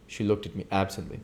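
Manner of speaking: ordinary delivery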